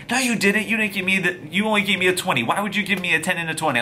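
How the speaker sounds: high, quick mock arguing; acted indignant denial. Bartender arguing back, acted quarrel.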